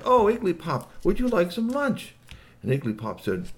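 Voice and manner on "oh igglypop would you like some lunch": high, inviting character voice; friendly hostess. Acted. Shui offers Igglypop some lunch, high and inviting. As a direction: offer it sweetly, pitched up high. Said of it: "she sat down and said".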